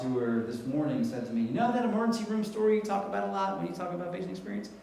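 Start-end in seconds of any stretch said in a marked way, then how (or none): 1.4-4.7 a higher, lightly voiced wife; wife's voice. Wife teasing her husband on the phone, pitched up, amused.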